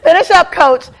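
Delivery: loud, high mock threat to the coach; hammed-up impatient mom; it's about to go down, loud and high; impatient ego. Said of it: "My ego is like".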